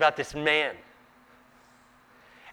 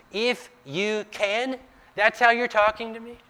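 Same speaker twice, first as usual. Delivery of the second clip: higher, scoffing disbelief, leaning on if; incredulous retort; 9 semitones higher than usual. Acted. Jesus throwing the father's doubt back at him, incredulous. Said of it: "throws it right back at him".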